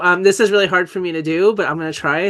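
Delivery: ordinary delivery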